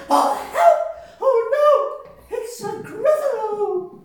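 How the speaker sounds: very high, loud squeals of fright; terrified mouse. Acted. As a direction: cry for help. Mouse meets Gruffalo.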